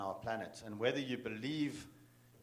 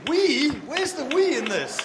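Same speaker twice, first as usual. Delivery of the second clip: much higher and louder, outraged; incredulous shout; exasperated why-me outcry; indignant disbelief; 12 dB louder than usual. Retold or imitated. Indignant retort to his companion.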